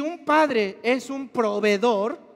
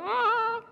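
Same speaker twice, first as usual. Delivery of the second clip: inner anguished groan; 12 semitones higher than usual. Retold or imitated. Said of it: "pero por dentro estaba".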